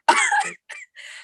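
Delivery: squealing high laugh; overjoyed winner squealing with laughter; giddy laugh. Real, not acted. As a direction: break into a disbelieving giggle.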